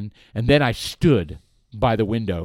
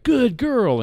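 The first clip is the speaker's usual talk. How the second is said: high-pitched doggy praise; sing-song reward voice; praising a dog; 12 semitones higher than usual. Acted. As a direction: coo it brightly to a pet.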